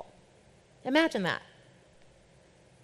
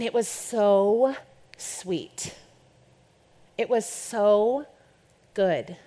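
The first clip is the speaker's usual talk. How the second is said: savoring; much slower than usual. Real, not acted. Savoring her break.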